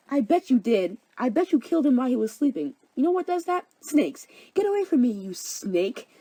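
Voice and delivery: high-pitched, heated accusing; angry accusation. Acted. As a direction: lash out in hammed-up outrage. Hermia accuses Demetrius of murder.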